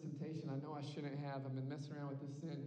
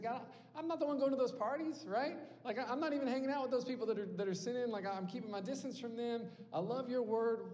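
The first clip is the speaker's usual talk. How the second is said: higher, louder, rushed self-justifying plea; whiny complaining prophet; 6 semitones higher, 3 dB louder than usual. Retold or imitated. Jeremiah pleading his case to God, higher and louder. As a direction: protest your innocence to God, a bit whiny.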